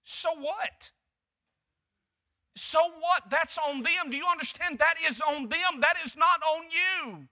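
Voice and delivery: rising to the point, pressing hard; emphatic preaching. Acted. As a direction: toss it back to the church with force. Preacher building.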